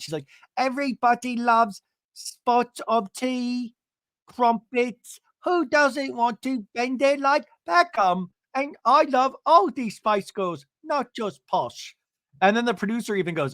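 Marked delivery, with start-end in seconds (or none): 0.6-11.9 high, slow, hammed-up fake British accent; bad British accent. Gina showing off for London, bad British accent. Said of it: "into a bad British accent".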